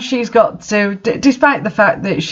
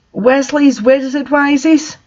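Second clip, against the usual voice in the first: "Wiseys" pitched up in disbelief; incredulous question; 8 semitones higher than usual. Real, not acted. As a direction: say it high, as if you can't believe it. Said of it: "Don't they mean Weasley's Wizard Wheezies?".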